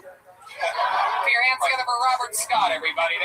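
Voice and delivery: loud, high host hype; announcer shout. Real, not acted. Host shouting the comic's name to the crowd.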